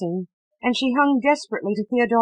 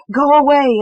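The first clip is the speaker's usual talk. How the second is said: high, loud terrified scream; 5 semitones higher, 8 dB louder than usual. A narrator's reading. Ghostly child screaming at Eleanor to go away, high loud shriek. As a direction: shriek it at the tormentor. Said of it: "it screamed".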